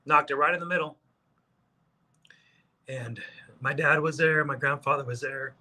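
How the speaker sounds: fighting back tears, clearing the throat; choked up. Real, not acted. Golfer recalling dad and grandfather watching his big putt, choked up. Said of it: "I'm gonna start crying again".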